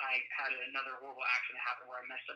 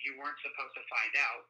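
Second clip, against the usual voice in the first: ordinary talk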